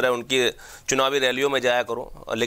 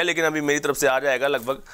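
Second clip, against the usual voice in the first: usual speaking voice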